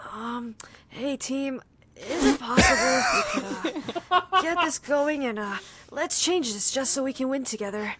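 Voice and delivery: timid, polite ask; Sykkuno voice. Retold or imitated. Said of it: "the Sykkuno voice".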